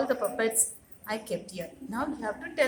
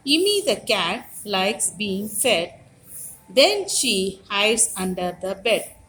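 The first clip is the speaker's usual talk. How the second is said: put-on slow, sing-song rhyme read to children; teacher reciting Immi the cat from the book; nursery rhyme recitation; 3 semitones lower, 6 dB louder than usual. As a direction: chant the rhyme slowly for little kids. Reads nursery rhyme aloud.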